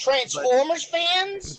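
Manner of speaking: mock surprise. Mock disbelief.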